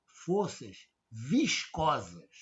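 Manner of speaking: "forças viscosas" stretched out slowly, each syllable clear; slow key term. Shown as a demonstration. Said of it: "Essas são as chamadas". (termo "forças viscosas" dito bem devagar)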